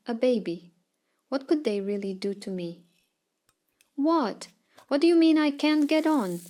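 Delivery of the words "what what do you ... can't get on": high, loud disbelieving outcry; loud, raised yell of disbelief; screaming. Retold or imitated. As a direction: shout it out in shocked outrage. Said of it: "I scream, surprised at myself".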